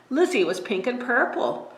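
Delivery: plain reading voice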